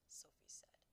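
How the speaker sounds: usual speaking voice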